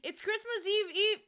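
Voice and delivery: high, loud sing-song line; Friends meme quote. Retold or imitated. Streamer tries the Friends meme for chat, high and bouncy. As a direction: bounce it like the sitcom catchphrase. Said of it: "How does the Friends thing go?".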